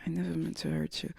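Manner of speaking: very soft, fading sorry; softly mocked apology. Her retelling the guy's text, barely voiced and soft. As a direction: breathe it out like a limp apology.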